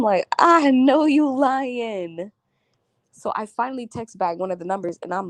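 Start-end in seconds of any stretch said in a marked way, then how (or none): 0.4-2.3 drawn-out, higher, mocking; slow knowing taunt. To the girl hacking her Snapchat, slow mocking taunt. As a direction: stretch it out like you caught her lying. Retold reply to hacker.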